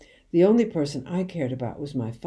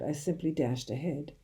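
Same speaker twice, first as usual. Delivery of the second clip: ordinary delivery, nothing marked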